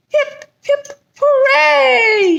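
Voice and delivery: high, excited crowd cheer; joyful hip hip hooray. A narrator's reading. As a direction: cheer like the whole neighborhood. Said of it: "he heard them all cheer".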